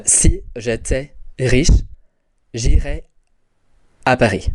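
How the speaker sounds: slow, careful French sentence for learners; French pronunciation. Shown as a demonstration. Teacher modelling French for the class, slow and careful. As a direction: spell out "Si j'étais riche, j'irais à Paris" slowly in French. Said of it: "And in French, we'd have".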